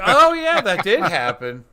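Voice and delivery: light mock surprise remembering; sudden realization. Retells sudden recall.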